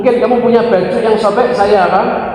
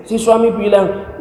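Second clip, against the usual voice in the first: ordinary talk, nothing marked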